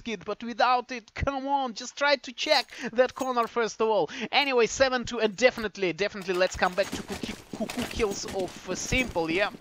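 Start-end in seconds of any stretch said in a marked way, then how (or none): none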